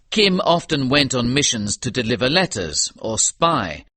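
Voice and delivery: ordinary delivery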